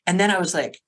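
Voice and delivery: ordinary talk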